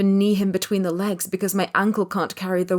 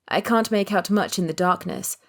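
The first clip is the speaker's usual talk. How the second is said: ordinary delivery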